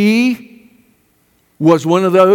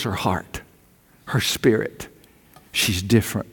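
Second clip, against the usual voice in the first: hushed and low; 9 semitones lower, 9 dB quieter than usual. Acted. Preacher drops voice about granddaughter.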